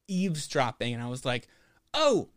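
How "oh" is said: excited yelp. Retold or imitated. Eager reply to Russian stranger.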